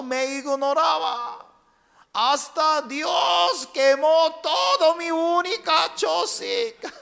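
high, loud anguished wail; desperate cry to God. Acted. Man stranded on island shouts at God, high desperate wail.

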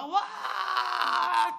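hammed-up frightened scream; startled scream. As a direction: yelp like a scared stranger. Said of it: "se asusta".

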